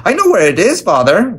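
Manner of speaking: put-on high-pitched, eager child's outburst; excited daughter calling out in a high voice; eager cry. A narrator's reading. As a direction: pipe up keenly, glad to help. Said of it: "Wendy cried".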